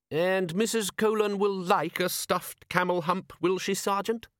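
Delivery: high, slow, sceptical questioning; doubtful. A narrator's reading. Patrician questions his sergeant's camel hump souvenir, doubtful. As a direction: draw it out in polite doubt. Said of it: "said the patrician doubtfully".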